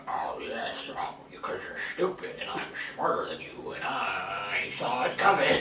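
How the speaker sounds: low, whispered smug villain; imitating Snoke. Mocking how Snoke talks, low whispered smugness. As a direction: breathe it out like a smug overlord. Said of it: "the way he talks".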